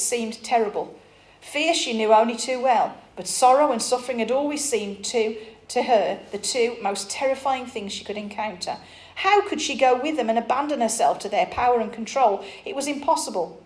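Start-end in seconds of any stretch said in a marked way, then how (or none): none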